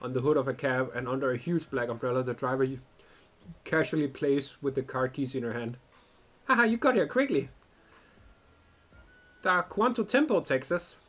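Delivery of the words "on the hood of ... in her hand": story narration. A narrator's reading. Streamer narrating the game text to viewers, calm storyteller. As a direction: read the scene text like a storyteller.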